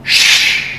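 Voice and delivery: sharp shush at the parents. Retold or imitated. Dad shushing the parents, a sharp hiss. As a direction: hush them with a hiss. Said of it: "I'm like".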